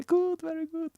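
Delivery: a sudden falsetto yes; squeaky high approval. Acted. Mister Lol at the rave, squeaking high approval. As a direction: jump way up in pitch, squeaky.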